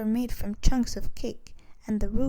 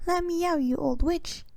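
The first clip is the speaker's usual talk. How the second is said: yelling; 7 semitones higher than usual. A narrator's reading. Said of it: "heard her brother yelling".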